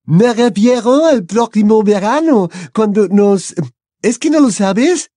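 put-on slow, high, loud French-accented gush; a delighted French girl.

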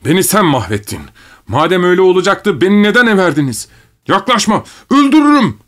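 very high-pitched screaming tirade; desperate rage, pitch climbing to a shriek; frantic furious threats. A narrator's reading. Same tirade repeated, more frantic.

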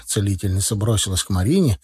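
ordinary delivery, nothing marked

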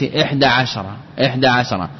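عشرة in two syllables, no vowel after sh; silent shin. Shown as a demonstration. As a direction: say عشرة as "ʿash-ra". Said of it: "نطق بإحدى عشرة بإسكان الشين". (نطق عشرة بإسكان الشين)

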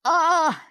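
loud, high pained cry. A narrator's reading. Little old man climbing onto the throne, loud cry. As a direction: cry out sharply in pain. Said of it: "he cried out".

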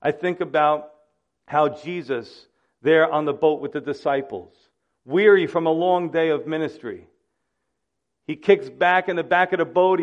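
ordinary talk, nothing marked

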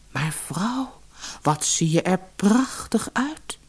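zacht, vol ontzag; verbaasd gefluisterd. Acted. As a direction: fluister vol bewondering. Visser vol bewondering. (ontzagvol gefluisterd)

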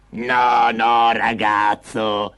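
put-on slow, drawn-out old woman's voice; vecchia signora. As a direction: draw it out like an old crone. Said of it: "disse la vecchia signora". (voce lenta da vecchia)

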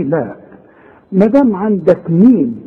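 ordinary talk, nothing marked

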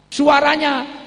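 sudden high loud cry; loud exclaim. Acted. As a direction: belt out the answer. Loud punchline answer.

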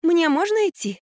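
high, bright delighted question; light up with relief. A narrator's reading. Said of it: "обрадовалась Марфа".

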